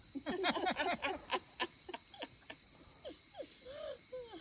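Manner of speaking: long, high burst of real laughter; bursting out laughing. Real, not acted. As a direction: crack up at the punchline. Laughing at the punchline.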